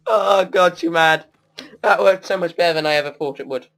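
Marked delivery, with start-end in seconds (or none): 0.1-1.3 gloating. Real, not acted. Gloating again at opponent.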